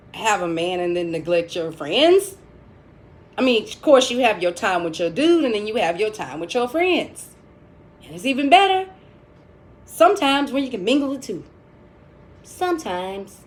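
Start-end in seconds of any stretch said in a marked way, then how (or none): none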